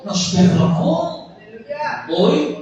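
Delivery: normal talking voice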